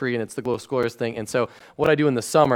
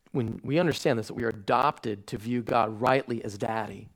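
ordinary delivery

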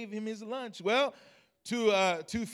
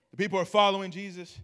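normal talking voice

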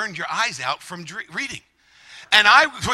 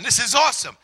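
ordinary talk

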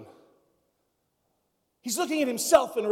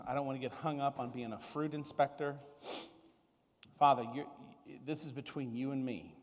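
soft, low, sincere pastor's prayer; hushed, heartfelt prayer; closing prayer; 6 semitones lower, 5 dB quieter than usual. Real, not acted. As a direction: close in quiet prayer before the congregation.

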